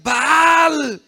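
high, loud, drawn-out invocation; long wailing call; pleading shouts to a god. Acted. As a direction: cry out like frantic prophets. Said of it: "¿Y sabe cómo decían?".

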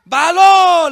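high, loud, drawn-out invocation; long wailing call; pleading shouts to a god. Acted. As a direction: cry out like frantic prophets. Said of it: "¿Y sabe cómo decían?".

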